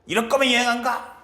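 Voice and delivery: lightly voiced huffy grandmother again insisting; hammed-up grandma sulk; angry grandma. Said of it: "할머니가".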